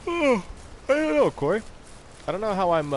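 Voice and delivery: mocking squeaky voice. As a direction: squeak it out to tease Corey.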